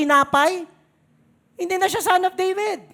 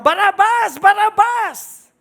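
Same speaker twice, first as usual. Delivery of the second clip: louder, higher retold mob shout; crowd shouting; 6 semitones higher, 7 dB louder than usual. Crowd choosing Barabas over Jesus, shouting loud. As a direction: shout the name back like the crowd. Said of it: "Sila yung simigaw na".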